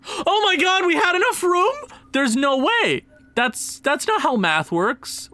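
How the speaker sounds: high-pitched cry of disbelief; can't believe the numbers, voice jumping up; shocked disbelief. Real, not acted. Gamer stunned by the salary cap, shocked cry.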